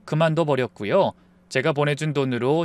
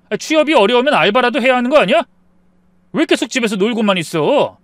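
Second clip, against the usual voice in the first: starts contained, grows higher and louder; growing anger; 4 semitones higher, 5 dB louder than usual. Acted. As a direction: let exasperation build into a heated complaint. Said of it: "저도 점점 화가 났어요".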